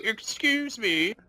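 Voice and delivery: high, slow, offended excuse me; haughty British. Retold or imitated. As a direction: act insulted and posh. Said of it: "And you're like".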